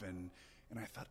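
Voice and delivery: ordinary talk, nothing marked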